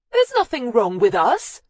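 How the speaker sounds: a high, loud wail; loud distressed wail of denial. Acted. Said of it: "The last came out as a wail".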